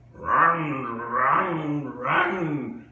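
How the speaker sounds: loud shouts of run; shouted warning. Retold or imitated. A sound shouting at passers-by to run. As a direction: yell it like a siren warning.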